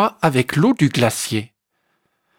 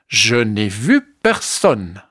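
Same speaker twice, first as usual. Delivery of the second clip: lower, slow dismissive ibex; 5 semitones lower, slower than usual. A narrator's reading. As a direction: drag it out like a proud ibex. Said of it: "répond le bouquetin".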